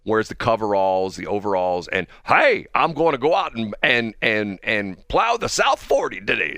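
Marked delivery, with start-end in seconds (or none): 2.2-6.6 higher-pitched farmhand putting on a hearty holler; Bubba the farmer. Pretend. Host parodying a guy in overalls off to plow, hammy farmer voice. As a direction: do a mock farm guy, drawn out at the end. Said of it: "That's Bubba".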